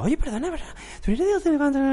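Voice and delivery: high, mock girlish voice asking; imitating a young woman. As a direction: fan girl asking about the show in a high mock voice. Voicing the girl in the queue.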